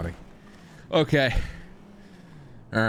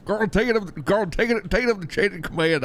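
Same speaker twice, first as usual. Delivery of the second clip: higher-pitched exasperated order; exasperated brush-off; 8 semitones higher than usual. Acted. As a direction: push the problem away, pitched up high. Brushing off Carl's report.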